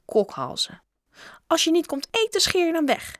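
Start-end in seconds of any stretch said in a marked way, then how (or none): 1.5-3.2 high-pitched scolding call; called out sharply. A narrator's reading. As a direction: shoo the children away. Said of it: "riep ze naar de groep kinderen". (streng geroepen, hoog)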